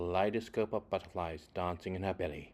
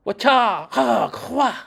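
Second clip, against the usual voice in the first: sharp high kiai yells, much louder; martial arts shouts; 15 dB louder, much slower than usual. Acted. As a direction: shout each strike like a fighter throwing a blow. Ready for action, fighting.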